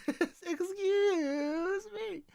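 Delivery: very high, slow, laughing through it; laughing Link impression. Streamer laughing through Link's line, very high. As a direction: crack up mid-impression. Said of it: "audio recording of that".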